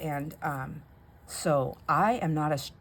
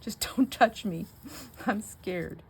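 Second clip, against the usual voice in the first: scared plea; 4 semitones higher, slower than usual. Retold or imitated. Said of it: "'Cause I'm like".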